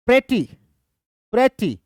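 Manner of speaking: "pretty" with strong pre, light falling ty; stress on PRE. Shown as a demonstration. Teacher repeats for students, weight on pre in "pretty". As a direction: punch the first syllable of "pretty", drop the second. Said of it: "पहला syllable stressed है".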